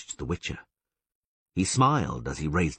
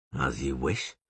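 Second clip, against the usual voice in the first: lower, unbothered; Witcher's dry calm; 5 semitones lower, 3 dB quieter, slower than usual. A narrator's reading. Witcher answering the elf, lower and unbothered. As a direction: shrug it off.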